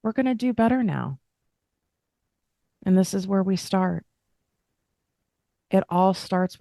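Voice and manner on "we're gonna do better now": a smiling promise to yourself. Shown as a demonstration. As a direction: say it again with a smile, brighter. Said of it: "And you can smile".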